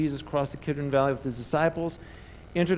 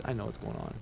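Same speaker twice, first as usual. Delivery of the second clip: quiet Jesus; 5 semitones lower, 12 dB quieter, faster than usual. Acted. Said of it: "Jesus is like".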